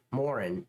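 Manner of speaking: ordinary talk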